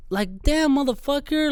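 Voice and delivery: high, exasperated cursing; annoyed. Retold or imitated. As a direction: curse them out, fed up. Imitating annoyed drivers.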